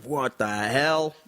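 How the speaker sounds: slow, drawn-out baffled disbelief, acted; mock bewilderment. People baffled by Aussie slang, mock-bewildered slow drawl. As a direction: stretch each word in mock confusion. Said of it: "that's where people go".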